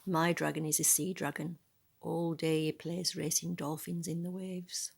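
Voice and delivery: plain reading voice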